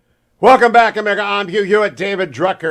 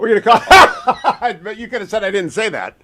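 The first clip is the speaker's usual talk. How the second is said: laughing through the words; real laughter breaking into the line; 5 semitones higher than usual. Real, not acted. Host amused by guest's slip, chuckling as he talks.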